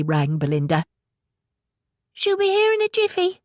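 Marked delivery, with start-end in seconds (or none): 2.1-3.4 put-on high, light boy's voice; young boy voice. A narrator's reading. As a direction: voice a young lad.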